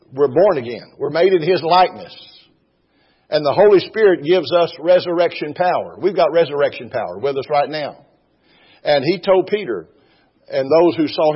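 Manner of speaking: high, loud fervent preaching; preaching at full force. Real, not acted. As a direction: lift the congregation, ringing out. Preaching climax.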